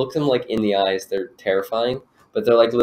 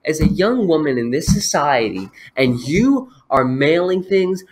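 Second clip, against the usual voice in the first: angry customer rant; 6 semitones higher, 5 dB louder than usual. Acted. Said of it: "She's just going off on him".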